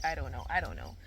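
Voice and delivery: dropping low on I don't know; playful drop to a low voice; mock shrug. Repeated, second much lower.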